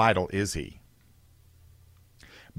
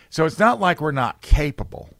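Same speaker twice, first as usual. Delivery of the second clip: ordinary talk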